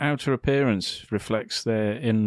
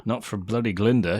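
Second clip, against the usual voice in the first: ordinary delivery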